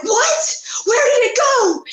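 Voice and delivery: high-pitched retold panicked cry; mock panic. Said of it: "And so I start panicking".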